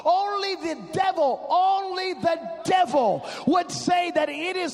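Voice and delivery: high, forceful preaching with stretched key words; fired-up preaching. Real, not acted. Preacher warns congregation about covid's spirit, fired-up preaching.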